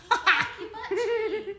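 loud, high laugh through the words; real giggling exclamation; laughing outburst. Real, not acted. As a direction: crack up mid-sentence. Laughs at her own reading slip.

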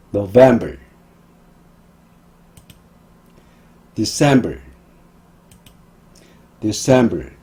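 "November" and "December" with strong VEM and CEM; light start, strong second syllable in "November", "December"; stress on second syllable. Shown as a demonstration. Teacher modelling months to repeat, second-syllable stress. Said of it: "모두 두 번째 음절에 강세가".

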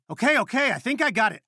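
high, flustered self-reassurance. Acted. Trying to fix the device, flustered. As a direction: pitch up, fumbling to get it working.

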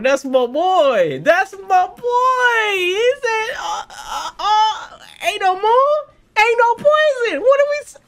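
slow, very high, loud fan scream; screaming excitement. Real, not acted. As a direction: shriek the hero's arrival in disbelief. Screaming praise, repeating the line.

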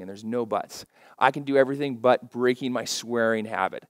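usual speaking voice

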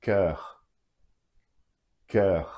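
"Cœur" with the French open eu vowel; French œ sound. Shown as a demonstration. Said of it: "Another one with the e dans l'eau".

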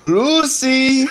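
high, over-the-top Cuban "Lucy"; [name] impression. A podcaster mocks the character's accent, calling out like [name]. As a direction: call out "Lucy" like Ricky Ricardo. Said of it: "you keep expecting him to say".

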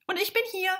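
high-voiced echo of the first goblin; second goblin voice. A narrator's reading. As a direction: pipe up in a high character voice. Second goblin answers from stairs.

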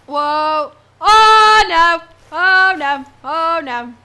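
loud, panicked "oh no"; real fright, blurting it out; startled cry. Real, not acted. Startled by monster in game.

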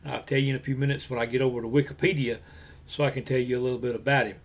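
ordinary delivery

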